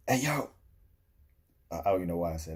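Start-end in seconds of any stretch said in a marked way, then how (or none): 0.1-0.5 loud, high shout for attention; yelled call. Retold or imitated. Bluffing to a crew by hailing fake friends, yelling. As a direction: call out to someone across the beach. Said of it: "And then I yelled out".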